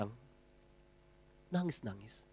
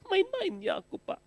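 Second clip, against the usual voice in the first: people excusing themselves. Retold or imitated. People excusing hurtful words.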